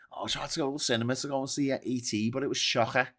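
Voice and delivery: quick Scouse impression; Liverpool accent. Liverpool local reporting a packed cinema, Liverpool accent. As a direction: rattle it off in Scouse, throaty on the last word. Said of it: "Liverpool people".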